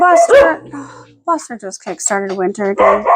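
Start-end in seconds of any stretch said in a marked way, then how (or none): 0.0-0.6 higher call to the dog. Real, not acted. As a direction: call his name across the room. Calling the dog.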